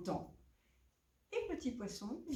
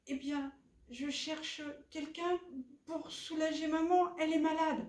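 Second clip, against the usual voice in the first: put-on higher-pitched child character; young fish; 7 semitones higher than usual. Little fish seeks help for sick mother, young fish voice. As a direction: answer in a lightly raised little-one voice.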